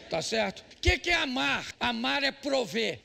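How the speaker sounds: usual speaking voice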